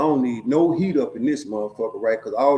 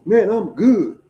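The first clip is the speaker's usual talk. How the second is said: higher, louder slurred drunk imitation; 7 semitones higher, 5 dB louder, slower than usual. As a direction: play the drunk insisting he's fine. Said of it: "in that motherfucking drunk voice".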